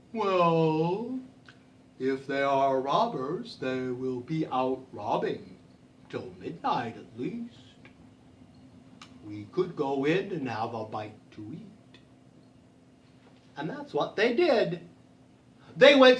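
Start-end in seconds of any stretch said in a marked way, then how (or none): 0.1-12.0 low, slow, soft cow voice; unhurried cow. Acted. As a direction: draw it out like a placid cow. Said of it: "And the cow, she said".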